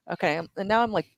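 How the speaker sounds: normal talking voice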